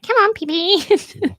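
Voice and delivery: very high, slow, cooing pet voice; dog voice. Pretend. Owner cooing at her dog outdoors, very high and slow. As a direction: coo at the dog, drawing it out. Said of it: "talking to her dog like that".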